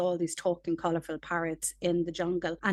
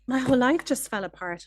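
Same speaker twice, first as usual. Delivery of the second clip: raised, louder imitation of a dismayed client; client's mock dismay; 6 semitones higher, 5 dB louder than usual. Therapist voices a client whose world collapsed, mock dismay.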